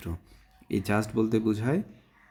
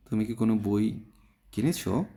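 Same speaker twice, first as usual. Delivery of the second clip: usual speaking voice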